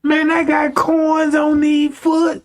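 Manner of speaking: high-pitched, worked-up grumbling; mad complaint. Acted. Griping about corns on his feet, mad. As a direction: pitch it up high like an indignant rant. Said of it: "I'm mad at the motherfucker".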